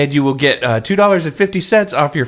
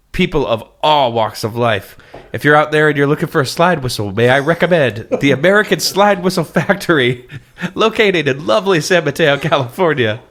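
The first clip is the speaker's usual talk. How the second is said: ad read voice; 3 semitones higher than usual. Acted. Mock ad read.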